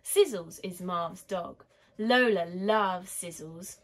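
usual speaking voice

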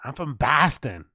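put-on Boston accent; mock Boston-accent impression. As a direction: play a Bostonian with flattened a's. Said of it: "sounds like somebody in Boston".

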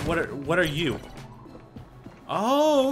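ordinary delivery

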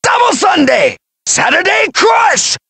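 shouted special-move names; battle shout; attack call. Acted. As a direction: yell the attack name as you fire. Fighter calling out special attacks.

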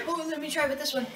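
normal talking voice

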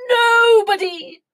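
loud, high, drawn-out whoop; gleeful king. A narrator's reading. King sliding down the banisters, gleeful. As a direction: call it out mid-slide.

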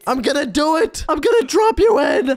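high villain shout; gloat, then yell with high glee; mad scientist. Acted. Tossing mom into nitrogen.

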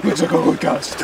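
high silly voice through laughter; laughing mock. Repeating the joke to his friend, laughing in a silly voice. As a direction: giggle out the dumb line. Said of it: "Our monkey brains".

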